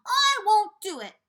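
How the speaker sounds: panicked refusal. Acted.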